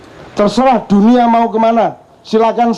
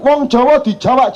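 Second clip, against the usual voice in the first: ordinary talk, nothing marked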